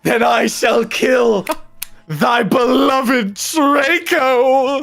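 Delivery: slow, hammed-up threatening shout; villain yell. Friend voicing Voldemort threatening Draco with a slow mock yell. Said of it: "He yelled".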